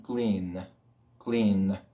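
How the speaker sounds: "Clean" with a humming N; low "Clean", letting the final N vibrate; vibrating N. Shown as a demonstration. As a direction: linger on the voiced N closing "Clean". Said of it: "Porque tenemos una vibración".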